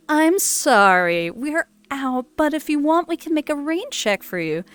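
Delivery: sugary fake-sorry rep letting a customer down gently; customer service voice. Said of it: "my customer service voice".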